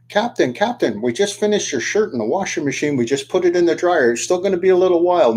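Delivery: deckhands calling up with bad news; mock yell. Mates yelling up to the captain from below, mock yell. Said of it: "they yell up".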